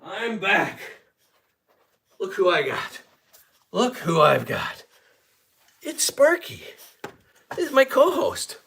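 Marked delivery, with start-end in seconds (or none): 2.2-8.6 excited. Real, not acted. Showing off the dog excitedly.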